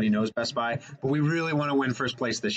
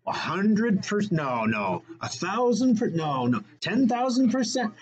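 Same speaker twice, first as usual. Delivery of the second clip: climbing higher with each guess; rising excitement; 6 semitones higher, slower than usual. Real, not acted. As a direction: build up, each number more excited. Escalating guesses of returns.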